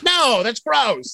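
slow, disgusted whine. Retold or imitated. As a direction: pull back, grossed out. Doctor voiced, disgusted.

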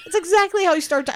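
loud, high-pitched falsetto. Acted. Podcaster describing Leatherface, high falsetto. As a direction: keep talking in the squeaky high put-on voice.

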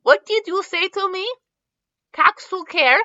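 put-on high-pitched heavy French accent. A narrator's reading. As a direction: play an affronted French bulldog. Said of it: "with a heavy French accent".

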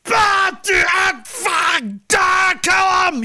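loud, high, drawn-out dumb bellowing; George Washington. Retold or imitated. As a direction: holler like a big dumb oaf. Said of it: "this George Washington character is hysterical".

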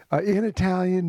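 normal talking voice

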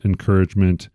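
usual speaking voice

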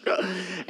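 mock startled gasp; high quick intake of breath; sharp gasp. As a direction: gasp as if the contract shocks you. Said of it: "it's like".

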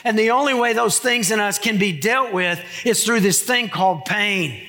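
ordinary talk, nothing marked

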